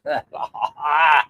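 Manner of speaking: open, drawn-out real laugh. Real, not acted. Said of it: "We laugh it down all the time".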